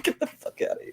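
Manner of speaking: unvoiced breathy dismissal; whispered. Real, not acted. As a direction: hiss it off under laughter. Dismissive laughing aside.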